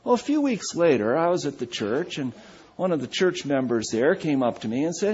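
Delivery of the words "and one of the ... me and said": normal talking voice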